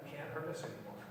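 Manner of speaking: ordinary talk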